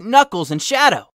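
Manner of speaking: usual speaking voice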